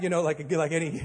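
ordinary delivery

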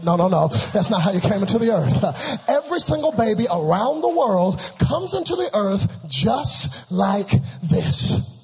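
loud, driving pulpit delivery; full-force preaching. Acted. Preacher recounts birth to congregation, full-force preaching. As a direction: fire rhetorical questions at the congregation.

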